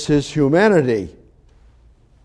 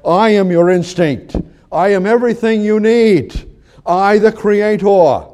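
God speaking; 6 semitones higher, 5 dB louder than usual. Acted. Preacher speaking as God to the congregation, commanding. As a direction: declare it as the creator himself. Preacher voicing God's words.

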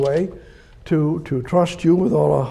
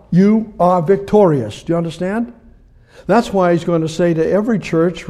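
ordinary talk, nothing marked

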